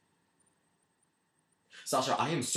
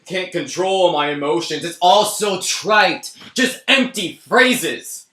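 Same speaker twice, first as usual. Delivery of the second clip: bitter mockery; 2 semitones higher, 8 dB louder than usual. Man mocking his own love affair, bitter rising scorn. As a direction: spit out each cliché with growing contempt.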